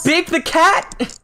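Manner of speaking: high, slow, falling disbelief; incredulous. Real, not acted. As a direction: say it flatly amazed. Repeated in disbelief.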